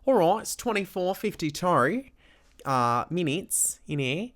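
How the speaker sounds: put-on Australian accent, with swooping vowels. Shown as a demonstration. Tired host tells listeners it's bedtime, in a mock Australian accent. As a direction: wrap up the show as a pretend Australian. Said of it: "Do you wanna hear my Australian".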